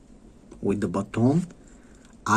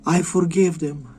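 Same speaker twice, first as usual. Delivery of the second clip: lightly voiced mother, higher pitch; forgiving mother; 6 semitones higher than usual. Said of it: "her mother is gonna be like".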